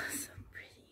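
hushed admiring whisper; soft whisper. Real, not acted. Admiring the doll's outfit, whispered. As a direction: barely voice it, leaning over the crib.

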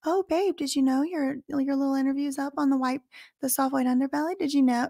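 high, soft mock sweetness, quick in the middle; mocking sugary mom. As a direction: parody a fake-doting mother. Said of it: "highly not credible that she said".

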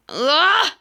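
startled cry. Real, not acted. Nearly falls.

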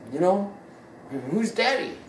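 ordinary talk

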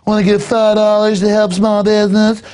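high-pitched put-on server voice; mock trendy barista. Trendy behind the counter asking customers for donations, mocking high voice. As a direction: parody a pushy counter pitch. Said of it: "they'll have the trendy behind the counter go".

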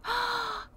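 short high gasp of surprise; sudden delighted gasp; quick gasp. Real, not acted. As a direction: gasp as you spot him. Spots the cat.

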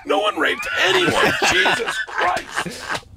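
high, loud comic yell; hammed-up fed-up dad screaming; retold exasperated shout. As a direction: blow up at the kids, stretching out the oath. Climax of retold argument with daughters.

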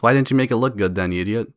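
ordinary talk, nothing marked

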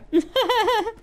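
a quick high-pitched giggle. Real, not acted. Laughing at chat.

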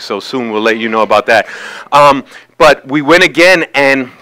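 normal talking voice

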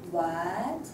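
"what" starting low, gliding up; rising tone. Shown as a demonstration. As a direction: lift "what" steadily to the end. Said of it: "it's a rising tone".

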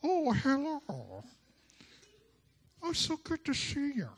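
fake church voice. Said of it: "Why are you talking funny?".

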